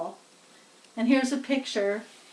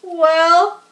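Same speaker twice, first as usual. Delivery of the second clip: higher, louder announcer question; loudspeaker asking; 6 semitones higher, 9 dB louder, slower than usual. A narrator's reading. Loudspeaker asks crowd about fainted pig, alarmed announcer. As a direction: call out a hint of alarm. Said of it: "said the loudspeaker".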